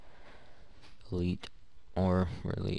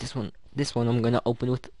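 put-on a botched first try at a British accent, a bit higher; failed British accent; 5 semitones higher, 4 dB louder than usual. Streamer botches a British accent opening a crate. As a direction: fumble into a bad British accent. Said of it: "oh, that was terrible".

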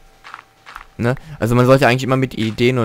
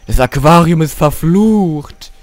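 mock spooky; 5 semitones higher, 6 dB louder, slower than usual.

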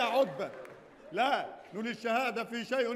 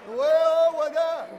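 loud, drawn-out indignant question; outraged shout; 3 semitones higher, 9 dB louder, slower than usual. Acted. Man throws her question back, shouting in outrage. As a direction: bellow it back slowly. Quarrel with the woman.

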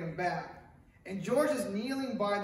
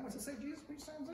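hushed prayerful ask; 8 semitones higher, 11 dB quieter, much faster than usual. Retold or imitated. Quiet time prayer.

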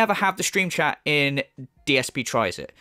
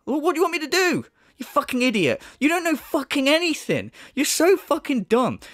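higher-pitched frustrated insults; exasperated anger; 7 semitones higher than usual. Real, not acted. Telling off the critic who keeps complaining, exasperated anger. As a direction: rant at him, fed up.

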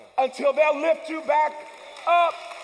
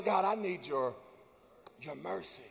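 softer, lower prayer; humble plea; 7 semitones lower, 10 dB quieter than usual. Retold or imitated. David softly pleading with God for mercy. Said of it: "he goes to God saying".

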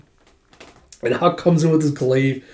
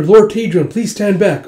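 high, raised call to a lord; urgent warning; 7 semitones higher, 6 dB louder than usual. Retold or imitated. Soldier to his lord in battle, urgent call. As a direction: urge him out of danger.

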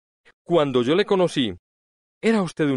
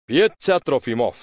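ordinary delivery

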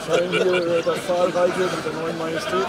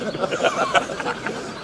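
hohes Auflachen. Real, not acted. Lachen über Sonnenbrillen-Bitte.

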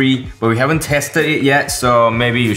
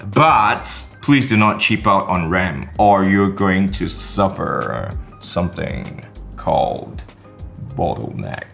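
ominous warning; 4 semitones lower than usual. Acted. Ominous warning about cheap ram.

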